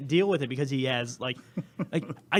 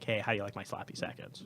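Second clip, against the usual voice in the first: quick, softer mock-cheeky jab; cheeky taunt; 7 semitones lower, 12 dB quieter, faster than usual. Dennis's brother taunting Harry, cheeky and soft. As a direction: toss off a cheeky dig under the breath. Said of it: "say in a cheeky way".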